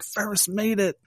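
high-pitched, hopeful little cheer; mock relieved triumph. Viewer thinking Ferris got home safe, high and thrilled. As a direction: imitate a viewer's premature joy.

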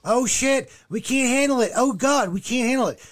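high-pitched imitation of panicked complaining; hammed-up, high mock cries of distress; mock screaming. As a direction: imitate them wailing in overwhelmed panic. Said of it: "they're, they're screaming, going".

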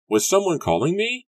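very high, loud, panting; out of breath from hurrying; breathless. A narrator's reading. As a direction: Matthew bursting in after running, breathless. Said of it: "he asked breathlessly".

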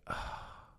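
soft breathy "ah" of regret; remorseful sigh. Retold or imitated. Husband realizing he hurt his wife, remorseful sigh. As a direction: let out a quiet, pained exhale. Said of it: "I was cut to the heart".

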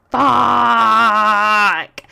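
long, loud retold "fuck"; drawn-out inner curse. Inner reaction to mom talking, drawn-out loud curse. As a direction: stretch the swear out in mock despair.

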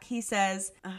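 usual speaking voice

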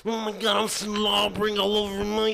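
high, slow hammed-up wrestler promo; wrestling promo. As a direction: cut a wild wrestling promo, slobbering. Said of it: "sign out with our best wrestling impression".

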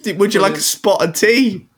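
high mock posh English; British accent. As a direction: parody the tea line in a high voice. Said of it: "He said".

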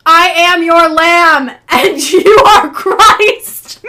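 slow, loud, laughing mock shout. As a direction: shout it out like a fanatic, cracking up. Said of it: "And shouted".

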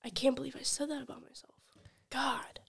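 fast, hushed mortified whisper; embarrassed whisper. Pretend.

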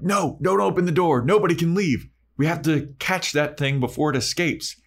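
urgent, scared commands; panicked orders. Retold or imitated. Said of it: "And they're like".

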